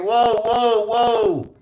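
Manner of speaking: high-pitched panicked whoas, imitated; screaming. Crew with hands up before a gun, screaming. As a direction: mimic men screaming to back off.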